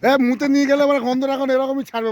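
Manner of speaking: high, loud laughing banter; excited joking. Real, not acted. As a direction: rib your friends loudly. Joking loudly among friends.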